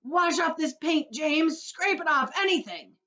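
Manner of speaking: high, loud, slow desperate begging; bawling plea. A narrator's reading. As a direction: beg for help, howling. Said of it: "bawled the centipede".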